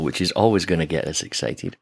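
ordinary talk

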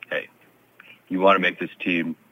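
lightly voiced old-school coach, long pauses between phrases; terse coach talk, doled out in fragments; a hint of a laconic hockey coach; Daryl Sutter impersonation. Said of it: "That is a great impersonation".

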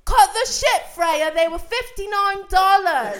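full-volume, high-pitched mock-British outburst; Joe screaming. Boyfriend accuses girlfriend in the cab, screaming in British accent. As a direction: explode in a put-on British accent, very loud. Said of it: "I would say more screaming".

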